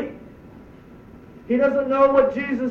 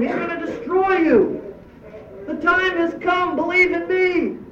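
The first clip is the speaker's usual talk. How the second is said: Jesus proclaiming; 6 semitones higher than usual. Pretend. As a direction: speak as Jesus calling people to repent. Preacher voicing Jesus proclaiming.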